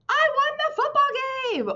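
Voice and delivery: teacher demonstrating an excited exclamation. Shown as a demonstration. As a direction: announce it like great news. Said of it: "Do you see how I'm really excited about everything".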